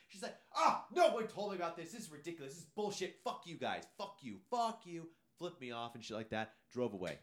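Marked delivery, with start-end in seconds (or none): 0.5-5.1 high-pitched mock tirade; indignant rant in a raised pitch; imitated angry woman. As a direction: play an outraged woman storming off. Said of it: "she starts yelling".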